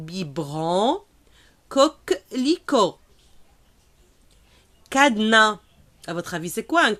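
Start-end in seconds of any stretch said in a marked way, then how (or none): 0.1-3.0 "Coquelicot" drawn out very slowly, ko-kli-ko; slow model word. Shown as a demonstration. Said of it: "Encore une fois". 4.9-5.6 "Cadenas" said as kadna, final S not sounded; silent S. Shown as a demonstration. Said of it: "Le S ici, on ne le prononce pas".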